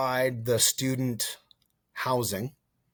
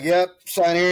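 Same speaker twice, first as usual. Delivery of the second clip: a raised, louder voice for the recruiter, higher and louder; 8 semitones higher, 8 dB louder than usual. Retold or imitated. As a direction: play the eager recruiter pushing the paper, pitched up. Recruiter voiced in retold story.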